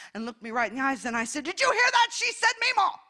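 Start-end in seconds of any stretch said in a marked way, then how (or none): 1.4-3.0 high-pitched, gushing excitement; thrilled grandmother. Retold or imitated. Grandmother announcing her granddaughter's first word, thrilled and high-pitched. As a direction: squeal it proudly, breaking toward a laugh.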